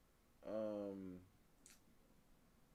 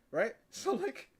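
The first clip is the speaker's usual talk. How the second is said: ordinary delivery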